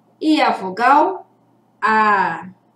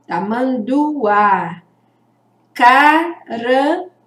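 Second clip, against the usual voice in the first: slow "Tamanduá" with every syllable drawn out; slow model word; slower than usual. Shown as a demonstration. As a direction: stretch "Tamanduá" for the class. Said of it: "Fala alto".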